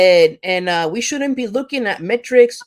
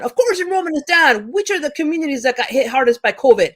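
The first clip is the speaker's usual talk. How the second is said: real anger, pitch shooting much higher; really angry; 7 semitones higher than usual. Real, not acted. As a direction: let the outrage climb until the voice cracks up high. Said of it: "I get really angry about this".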